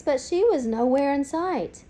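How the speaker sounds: plain reading voice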